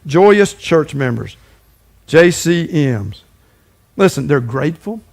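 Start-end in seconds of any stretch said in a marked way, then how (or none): 0.1-3.2 very slow, weighted naming; slow deliberate contrast label; slow emphatic label. Shown as a demonstration. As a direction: let each word land slowly. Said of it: "the opposite of that is J C Ms".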